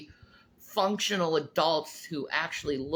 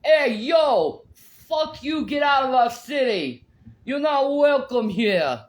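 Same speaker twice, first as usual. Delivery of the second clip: put-on loud, brash New York accent; 6 semitones higher, 7 dB louder than usual. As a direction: yell it like an angry New Yorker.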